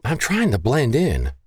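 mild, high-voiced reproach; admonishing. A narrator's reading. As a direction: chide a friend who's giving you away. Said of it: "the woman admonished".

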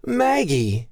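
high, delighted greeting; enthused. A narrator's reading. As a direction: greet a friend you're surprised to find. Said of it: "Genesis enthused".

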